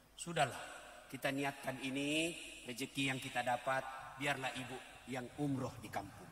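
soft, low, gentle pleading husband. Retold or imitated. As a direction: softly ask the wife's blessing. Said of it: "Ada kan biasa suami". (suami membujuk istri dengan lembut)

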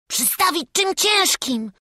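put-on high, loud boy's voice; urgent lad giving a quick solution; excited child, high-pitched; Wawrzuś. A narrator's reading. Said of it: "odparł Wawrzuś".

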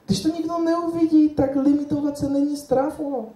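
put-on high-pitched German-accented Czech; German lecturer character. German lecturer urges shy Czechs on, high accented voice. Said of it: "Já se vžiju do role".

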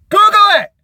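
laughing shout to a viewer; yelling out. Real, not acted. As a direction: yell it out with a laugh. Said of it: "just yelling out".

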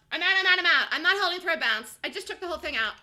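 ordinary talk

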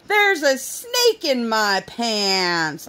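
put-on slow, drawn-out kid voice; a hint of a teasing child; imitating daughter. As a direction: stretch each word like a silly toddler. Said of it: "she proceeded to keep going".

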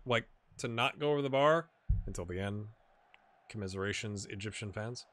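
ordinary delivery

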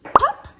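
high, loud popped word; pop sound effect. Acted. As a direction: snap it out like a bubble bursting.

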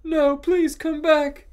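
high, loud, drawn-out plea; sad shouting. A narrator's reading. Draco begging Ebony to return, sad shout. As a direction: beg loudly, hamming up the heartbreak. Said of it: "shouted Draco sadly".

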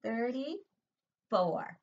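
very slow, stretched "thirty-four"; slow teacher modelling of "thirty-four"; drawn-out number. Shown as a demonstration. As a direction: stretch it out for little ones. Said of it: "And then comes".

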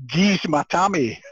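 slow, forceful Scottish demand; heavy Scottish accent, stretched words. Retold or imitated. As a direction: demand it in broad Scots, drawn out. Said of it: "in my Scottish accent".

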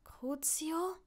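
frightened murmur rising in pitch; fearful whisper. A narrator's reading. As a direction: ask it softly, afraid of the word. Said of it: "nicht mehr als ein angsterfülltes Flüstern".

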